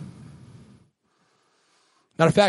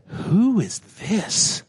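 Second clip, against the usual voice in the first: awed question; 5 semitones higher, much slower than usual. Acted. Disciples ask in awe.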